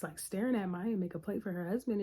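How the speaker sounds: usual speaking voice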